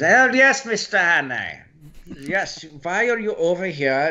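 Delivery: high-pitched German spy accent. Shown as a demonstration. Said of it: "go into German".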